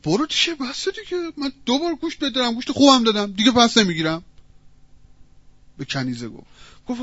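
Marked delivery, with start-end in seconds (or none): 0.0-4.2 higher-pitched, fed-up butcher; storyteller playing an irritated shopkeeper; acted exasperated refusal. Butcher turning away the maid's returned meat, exasperated.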